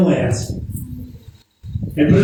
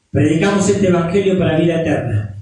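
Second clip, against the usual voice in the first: ordinary delivery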